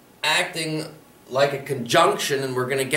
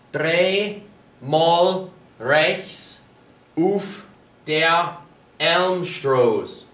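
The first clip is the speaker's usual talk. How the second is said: slow teaching model; much slower than usual. Shown as a demonstration. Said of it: "so to turn right".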